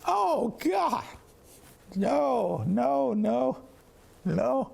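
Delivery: high, wailing "oh God, no no no"; mock dismay. Teachers hearing he's from Kansas, in high mock horror.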